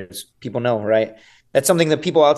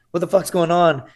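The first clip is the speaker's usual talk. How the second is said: high gasp, then bewildered swearing; startled awake; 5 semitones higher, slower than usual. Acted. Man waking somewhere unfamiliar, acting out a startled wake-up. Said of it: "I wake up and I'm not on my couch, I will always".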